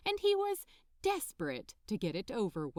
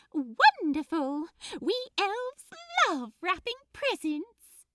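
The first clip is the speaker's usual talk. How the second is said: excited elf; 11 semitones higher, 7 dB louder than usual. Acted. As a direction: squeal it with delight. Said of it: "clasping her hands together excitedly".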